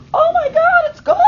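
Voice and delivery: high squealing mock excitement; excited colleague. Teacher imitating an excited colleague with a high squeal. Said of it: "he doesn't talk like that".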